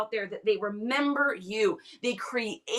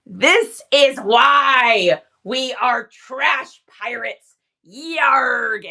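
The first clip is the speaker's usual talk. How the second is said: mock pirate; 9 dB louder, much slower than usual. Playful trash pirate boast.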